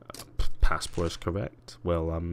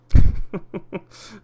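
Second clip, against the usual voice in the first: loud, high burst of laughter; real laugh; 13 semitones higher, 16 dB louder than usual. Real, not acted. Streamer amused a viewer turned up, loud laugh.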